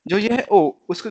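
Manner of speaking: ordinary talk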